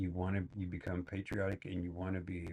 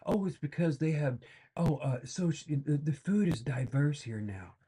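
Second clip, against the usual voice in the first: mocking naive liberal; 6 semitones higher, 4 dB louder than usual. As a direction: gush naively about the diverse food. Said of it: "lay down and take it".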